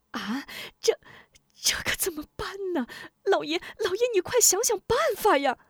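high whisper rising to a fast frantic plea; flustered, begging him to act; panicked plea. A narrator's reading. Wife panics and begs her husband for a plan, high and frantic. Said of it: "慌了手脚".

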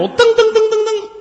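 high, loud mimicked running footsteps; footstep sounds. Storyteller mimics footsteps rushing to the river, high and loud. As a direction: rattle off the steps like feet hurrying.

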